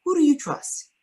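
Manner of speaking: the social worker asks him point blank. Retold or imitated. Social worker's question, pitched high. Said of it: "she says".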